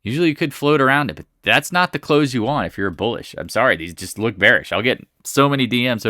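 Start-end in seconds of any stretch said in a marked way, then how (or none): none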